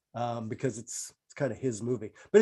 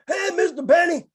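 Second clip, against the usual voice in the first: high shouted butler greeting; Rochester voice; 4 dB louder than usual. Retold or imitated. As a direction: call out high like the radio butler. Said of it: "he talked like this".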